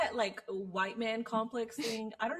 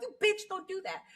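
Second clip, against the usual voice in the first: annoyed scolding; 9 semitones higher than usual. Retold or imitated. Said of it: "I'm like".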